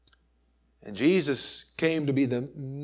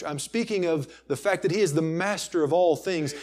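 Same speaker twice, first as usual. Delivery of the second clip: ordinary delivery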